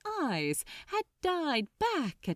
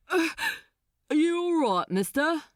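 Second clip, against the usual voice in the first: loud stoker; 9 semitones higher, 6 dB louder, faster than usual. Acted. Stoker shouts at waking man.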